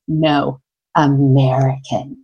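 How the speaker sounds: low, slow, disdainful verdict; deep disdain. Retold or imitated. French woman judging the foreigner to her friend, disdainful. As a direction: drop it low and slow, dismissing with contempt. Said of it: "with the most deep disdain".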